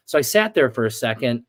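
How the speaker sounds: ordinary talk, nothing marked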